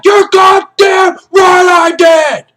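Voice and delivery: very high, loud, slow roar; explosive shout. Retold or imitated. Jessup roars his confession at Kaffee. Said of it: "He's like".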